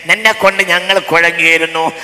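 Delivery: fiery Malayalam sermon voice; preaching at full force. Acted.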